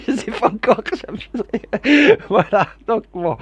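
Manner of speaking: high, slow, through laughter; laughing at his own mistake. Real, not acted. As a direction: giggle while admitting the slip.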